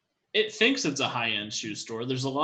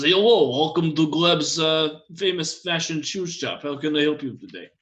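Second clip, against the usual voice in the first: a hammed-up Russian salesman greeting customers; Russian accent; 4 semitones higher, 4 dB louder than usual. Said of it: "thick Russian accent".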